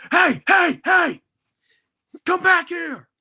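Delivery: high, loud shout calling someone back; attendant shouting. Retold or imitated. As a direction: bark at the friend running out of the bathroom. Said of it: "the bathroom attendant goes".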